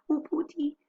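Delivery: high, fearful cry of Oh, Pooty; a nervous wife calling her husband, pitched high; scared woman in the night, voice lifted high; frightened wife. Acted. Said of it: "frightened fatalist".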